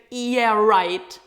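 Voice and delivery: loud, dismissive mock of her reply; sarcastic yeah right. Mimicking the cat owner brushing off critics, loud and sarcastic. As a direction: toss it off with scorn. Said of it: "And she's like".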